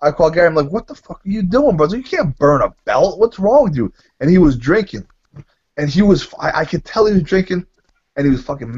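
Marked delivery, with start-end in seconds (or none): 0.7-3.9 higher-pitched, indignant telling-off; retold angry scolding. Caller scolds Gary over the burnt belt, retold anger. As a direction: replay an exasperated rebuke. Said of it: "I was getting pissed".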